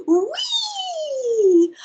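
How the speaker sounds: high, long gleeful whee. Acted. Boy swings away from the baboons, gleeful. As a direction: swing out with it, thrilled.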